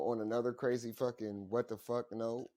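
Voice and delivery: ordinary talk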